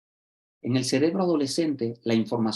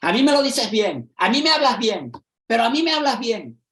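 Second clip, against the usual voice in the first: much higher and louder, indignant; defiant teen outburst; 10 semitones higher, 6 dB louder than usual. Retold or imitated. A student snapping back at the teacher, loud and indignant. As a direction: hammer the "me" like an insulted teenager.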